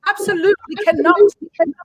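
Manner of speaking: prim royal English accent; English like the Queen. Shown as a demonstration. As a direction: talk like the Queen, extremely properly. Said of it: "Do like the Queen".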